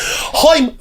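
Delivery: high Irish "Hoim" with a breathy H; Irish accent. Shown as a demonstration. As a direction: squeak the H hard, Irish style. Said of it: "This is how you say".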